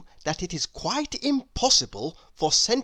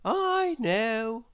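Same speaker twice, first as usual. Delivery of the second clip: weary sigh; 5 semitones higher than usual. A narrator's reading. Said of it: "sighed little Miss Brainy".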